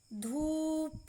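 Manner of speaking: धूप with the oo held long. Shown as a demonstration. Teacher modelling long vowels for learners, stretched oo in धूप. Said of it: "ऊ के उच्चारण में".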